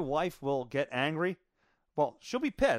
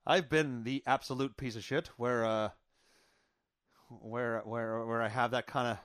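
ordinary talk

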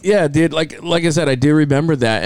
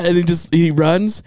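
ordinary talk

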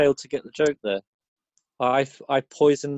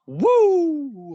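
Ric Flair woo; 14 semitones higher than usual. Retold or imitated. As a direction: let out a long high Flair woo. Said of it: "he says this".

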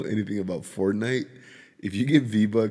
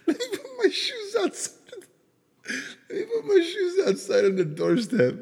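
put-on squeaky high kid voice breaking into laughter; laughing child voice. Dad imitating his son's shoe plan, laughing hard. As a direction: imitate the little son while crying with laughter.

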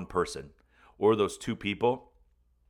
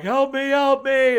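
high, loud, drawn-out cry; acted-out slow, loud plea; yelling for help; 8 dB louder than usual. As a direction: yell desperately for your wife. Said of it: "I'm yelling for my wife".

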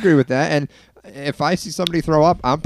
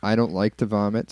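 normal talking voice

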